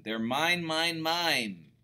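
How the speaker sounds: loud whiny chant of mine; hammed-up greedy pug insisting; mock tantrum. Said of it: "Pig flipped his wig".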